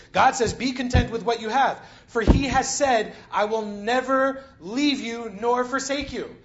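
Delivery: loud, slow pulpit proclamation; full-force preaching. Acted. Pastor proclaiming scripture to congregation, loud and slow. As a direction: thunder the promise out to the room.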